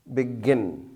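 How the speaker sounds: "begin" with the stress on gin; stress on last syllable. Shown as a demonstration. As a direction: lean on the second syllable of "begin". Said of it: "the last syllable is stressed".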